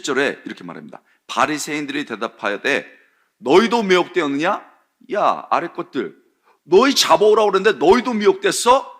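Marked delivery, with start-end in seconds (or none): 3.4-8.9 higher, louder scornful rebuke; scornful scolding. Retold or imitated. Pharisees scolding their own officers, loud scornful rebuke. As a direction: chew out the underlings.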